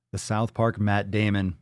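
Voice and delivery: usual speaking voice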